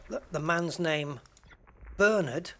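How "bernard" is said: "Bernard" as BER-nud, first syllable punched; first-syllable stress. Shown as a demonstration. Host showing the British name, "Bernard" stressed as BER-nud. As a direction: hit BER in "Bernard", weak second syllable. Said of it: "emphasis on the first syllable".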